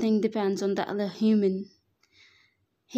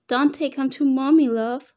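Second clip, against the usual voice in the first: put-on loud, higher call to a wandering child; calling him back; 3 semitones higher, 7 dB louder than usual. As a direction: coax him back from a distance. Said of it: "I called him again".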